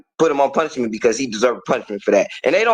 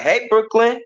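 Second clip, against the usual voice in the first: higher and rising on "Brooklyn", trying to get her attention; calling her again; 8 semitones higher, slower than usual. Retold or imitated. Stepdad calling Brooklyn a second time after she ignores him, pitched up and rising. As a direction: call "Hey, Brooklyn" again, pitch jumping up on "Brooklyn". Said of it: "And I'd be like".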